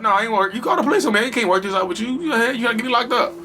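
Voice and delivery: hammed-up blaming ex; cold abuser. Abuser voiced in retold scene.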